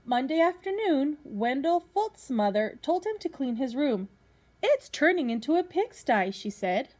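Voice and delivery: lively storybook reading to a class; reading to children. Acted. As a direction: read it out like a teacher at story time. Teacher reads picture book to class.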